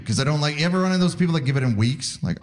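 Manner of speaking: normal talking voice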